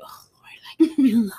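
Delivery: breathy, exasperated aside under the breath; mock-weary whisper about a talkative dad. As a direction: sigh it out as if pleading to be left alone.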